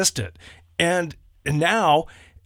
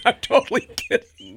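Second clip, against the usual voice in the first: laughing; 10 semitones higher than usual. Real, not acted. Teasing with laughter.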